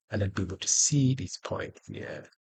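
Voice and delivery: ordinary talk, nothing marked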